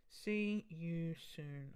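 very slow, stretched syllables; drawn-out sign-off. Real, not acted. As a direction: stretch each word out lazily while busy. Drawls it while texting friend.

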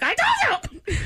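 high mock yell; shouting. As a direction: yell it in triumph. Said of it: "you're like".

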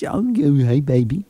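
put-on cooing baby talk on hey baby. Shown as a demonstration. Host contrasts baby voice with the boyfriend's plain greeting, cooing. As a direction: coo it sweetly. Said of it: "that is baby voice".

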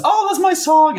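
high delighted squeal; thrilled, voice jumping way up; excited. Retold or imitated. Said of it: "and I'm just".

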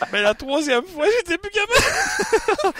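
giggling harder and harder; laughing. Real, not acted. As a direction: voice breaking up with laughter. Laughter takes over.

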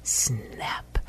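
soft, stretched-out whispery snap; drawn-out camera snap. A narrator's reading. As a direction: let the last shutter click linger, hushed. Last camera click, drawn out softly.

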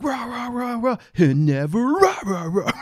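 high, loud barking rah chant; mock scream vocal. Shown as a demonstration. Reviewer imitating early 2010s scream vocals, high and loud. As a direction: bark the rahs like a metalcore scream. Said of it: "when you do, like".

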